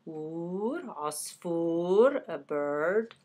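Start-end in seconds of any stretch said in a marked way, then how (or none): 0.1-0.8 ور pulled out alone, very soft; ور said on its own, softly; isolated syllable. Shown as a demonstration. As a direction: isolate the ور sound quietly. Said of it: "there is a wa sound in it".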